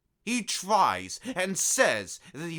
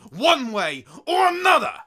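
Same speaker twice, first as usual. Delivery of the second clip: much higher, loud shouted vow; 13 semitones higher, 9 dB louder than usual. Acted. Emperor swearing justice on the coward, shouting. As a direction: slam the threat home.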